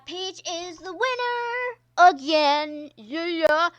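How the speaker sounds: announcer cheer. Pretend. Cheering Peach's win to viewers, loud high announcer voice. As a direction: trumpet the win like a race host.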